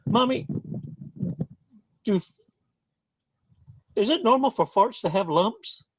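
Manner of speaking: put-on high, halting kid voice; small boy working up to a question; little boy asking Mommy. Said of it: "looks at his mommy and says".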